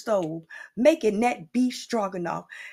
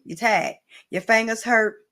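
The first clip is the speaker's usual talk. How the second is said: ordinary talk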